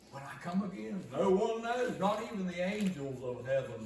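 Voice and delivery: high and loud, drawing out no one knows; ringing proclamation. Acted. Preacher voicing Jesus to the congregation, ringing proclamation. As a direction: proclaim it to the whole room.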